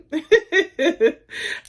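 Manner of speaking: loud, high giggling laugh. Real, not acted. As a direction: laugh it off loudly. Laughing off the accent rant.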